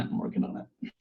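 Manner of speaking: whispered, under the breath; a soft whisper to yourself. Real, not acted.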